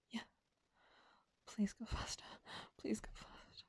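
ordinary talk, nothing marked

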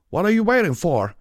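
loud, high straining shout; strained shout. A narrator's reading. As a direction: yell it over the strain. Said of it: "Applejack grunted".

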